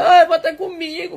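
high, hammed-up plea to come back; a touch of lovesick whining; mock pleading. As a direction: beg her back with fake drama. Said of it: "fazendo aquele drama".

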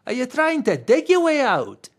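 high, loud teasing shark; old shark teasing Green over the hole; teasing quip. A narrator's reading. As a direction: rib him with a grin. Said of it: "quipped the old hammerhead".

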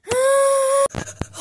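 loud, high-pitched gasp; high shocked gasp. Acted. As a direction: gasp in sudden amazement. Toy trick suddenly works.

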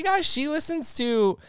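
ordinary talk, nothing marked

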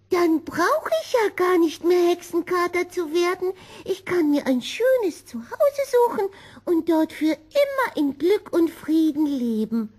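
high, eager kitten voice; a small cat brightening at a new plan, pitched high; hopeful little cat. Acted. Kitten Gobolino's happy idea.

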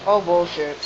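usual speaking voice

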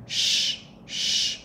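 sh hushed with rounded lips; a soft hushing sh; sh sound. Shown as a demonstration. Teacher showing the sh, like hushing a child to pee. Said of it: "đọc cái âm phía trên".

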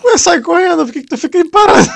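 high, loud mocking call; loud high taunting. Real, not acted. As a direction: jeer at him loudly. Yells at NPC standing still.

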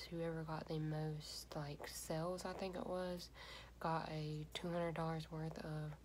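lips covered by wet sheet; speaking through face covering; talking through a sheet mask. Real, not acted. Seen 1: Red printed sheet mask covers face and lips throughout labelled words.

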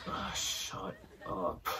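very soft, slow, low grumble; hushed, drawn-out annoyed mutter; muttered under breath. Retold or imitated. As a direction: mutter it slowly, barely voiced. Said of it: "I just mutter under my breath".

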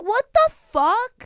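high-pitched, drawn-out incredulity; mock disbelief. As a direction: stretch it out high in exasperated disbelief. Acts out disbelief at friends booking.

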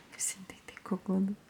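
breathy whisper. Real, not acted. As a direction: say it under your breath. Whispered aside.

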